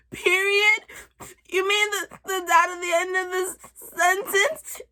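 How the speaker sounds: put-on high, crying child's question; tearful confusion. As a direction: whimper it, baffled and scared. Scared child questions her mom.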